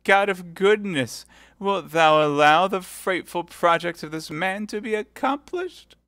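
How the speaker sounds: high, slow tearful plea; voice broken by tears. Acted. Milady feigning tears in prayer for Felton to hear. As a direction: cry out to God through tears. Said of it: "in a voice broken by tears".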